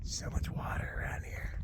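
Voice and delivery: breathy unvoiced whisper. Real, not acted. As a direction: murmur it to yourself under the breath.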